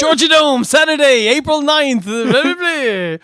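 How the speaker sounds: high mock announcer rattle-off; excitable parody of a promo sign-off; imitated wrestling promo. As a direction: send up the event plug, pitched high. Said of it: "He's like".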